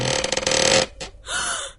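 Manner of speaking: sharp breathless gasp of horror; startled breathless gasp; shocked gasp. Acted. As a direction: suck in air at a terrible sight. Girl sees horrifying kitchen.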